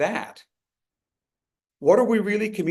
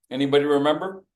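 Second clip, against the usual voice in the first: ordinary talk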